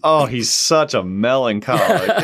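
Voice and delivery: wife's loud, amused verdict; exclaiming wife. Retold or imitated. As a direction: imitate an exasperated wife, half laughing. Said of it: "my wife, who's an extremely choleric ... and she went".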